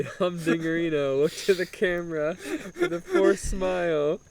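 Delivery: ordinary delivery, nothing marked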